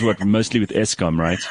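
ordinary delivery

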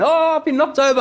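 high, hammed-up whimper of a faker; mock whiny victim. Man lying in the street to passer-by, high mock whine. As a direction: play the man pretending he was hit.

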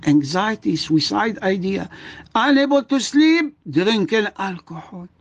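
ordinary talk, nothing marked

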